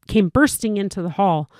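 ordinary delivery, nothing marked

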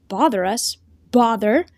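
mildly incredulous, repeated word rising; understated outburst from a boy; voice breaking. A narrator's reading. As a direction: echo it with a touch of scorn. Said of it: "the boy's voice broke".

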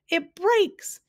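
very high-pitched, delighted cry; high, overjoyed squeal; joyful gasp. A narrator's reading. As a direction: gasp it out in bliss. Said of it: "he gasped joyfully".

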